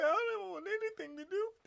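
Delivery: scared to death. Retold or imitated. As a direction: play the scared skinny guy pleading innocence. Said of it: "He's scared to death".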